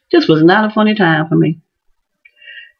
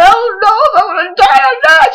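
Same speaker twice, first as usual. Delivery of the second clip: high, tearful cry of panic; retold panicked cry; 12 semitones higher, 3 dB louder than usual. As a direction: wail it out, truly unsure. Teacher answers the child, panicked.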